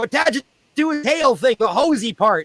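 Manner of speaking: put-on high kids' voices; kids instructing. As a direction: explain eagerly as the kids. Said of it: "They go".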